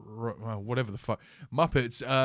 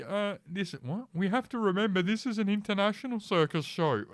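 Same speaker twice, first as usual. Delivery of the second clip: mock director voice; 9 semitones higher than usual. Quoting the circus director.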